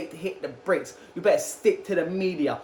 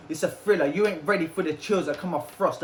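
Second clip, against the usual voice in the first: ordinary talk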